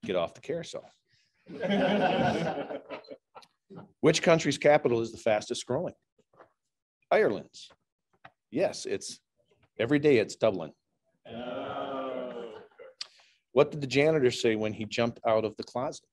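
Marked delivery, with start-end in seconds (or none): 1.5-3.1 laughing at own joke. Real, not acted. 11.3-12.5 groan at the pun. Real, not acted. Groan after own pun.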